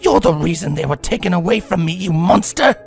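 seething. A narrator's reading. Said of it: "Rarity seethed".

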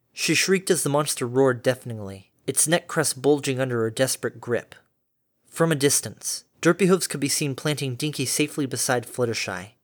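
usual speaking voice

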